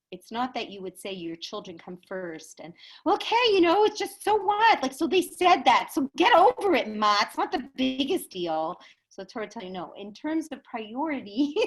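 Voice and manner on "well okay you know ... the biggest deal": high, loud, brush-it-off voice; acted-out exasperated shrug-off; dismissive mock retort. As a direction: wave it away impatiently. Voices someone brushing off parents' hurt.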